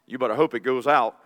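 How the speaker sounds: ordinary talk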